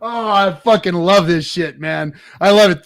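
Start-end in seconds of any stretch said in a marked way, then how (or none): 2.4-2.8 loud, higher burst of joy; exultant shout. Real, not acted. Host celebrating the rival's fall, loud and joyful. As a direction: let it burst out.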